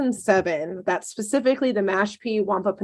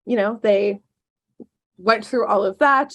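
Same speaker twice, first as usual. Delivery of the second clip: ordinary talk, nothing marked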